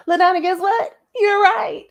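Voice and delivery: laughing tease to get on his nerves; teasing sing-song. Shown as a demonstration. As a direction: say it to annoy him. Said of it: "so I can get on his nerves".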